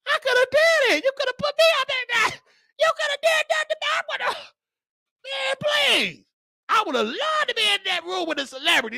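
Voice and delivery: high, fast camp falsetto, half shouted. Pretend. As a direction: gush like a starstruck diva, way up high. Flamboyant falsetto fantasy rant.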